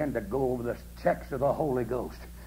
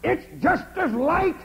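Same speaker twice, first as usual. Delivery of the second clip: high, loud amazed cry; preacher retelling a man's amazed yell at sunset; excited yell; 7 dB louder than usual. Retold or imitated. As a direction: shout it out in wonder at the light. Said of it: "Suddenly that man yelled or screamed and said".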